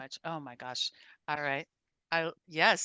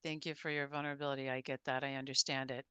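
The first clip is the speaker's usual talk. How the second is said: soft, low, sincere thanks; heartfelt thanks; 4 semitones lower, 11 dB quieter than usual. Real, not acted. Host quietly thanking the guest for his vulnerability, tender. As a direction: quietly tell him you understand.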